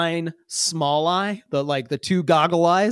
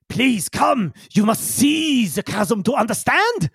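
higher, far-off alien voice; a big creature urging them to come see; Yigzel; 6 semitones higher, slower than usual. Acted. Yigzel beckons the crew toward the chasm, higher alien voice.

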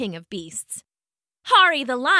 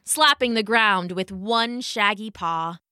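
ordinary delivery

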